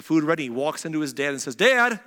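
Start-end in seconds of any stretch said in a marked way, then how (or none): 1.6-2.0 high, loud call to Dad; impersonating a brother. Acted. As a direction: call out to your father in a put-on higher pitch. Jacob posing as Esau calls father.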